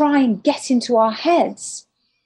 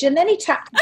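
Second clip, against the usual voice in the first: normal talking voice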